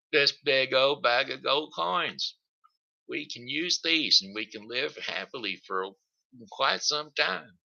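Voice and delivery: lightly voiced excited boy; boy showing off his treasure to mother; Jack, excited.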